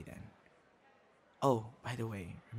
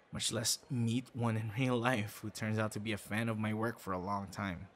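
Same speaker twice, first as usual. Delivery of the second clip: usual speaking voice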